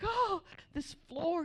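high, drawn-out lament; imitated dismay. Mother sees Sharpie on the tile floor, mock dismay. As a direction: wail a little, like a dismayed mom.

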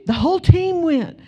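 imitating a frightened football player; scared boyfriend. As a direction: blurt it out in fright.

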